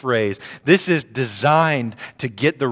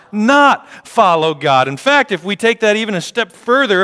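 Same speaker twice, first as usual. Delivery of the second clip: loud, higher, building preacher cadence; preaching at full force; 8 semitones higher, 7 dB louder than usual. Acted. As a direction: drive the point home to the congregation.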